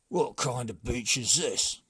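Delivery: the brigand chief, fed up; gruff chief. A narrator's reading.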